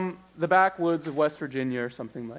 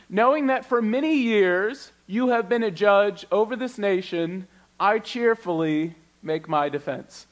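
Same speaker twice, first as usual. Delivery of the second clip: lifted Bible reading voice; scripture reading; 5 semitones higher, 5 dB louder than usual. Acted. Pastor reading Paul's reply to the governor, lifted. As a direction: read Paul's defense aloud with lift.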